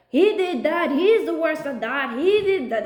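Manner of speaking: imitating politicians blaming each other; mock accusing bickering. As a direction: parody the petty blame game. Mocking attack-ad style political bickering.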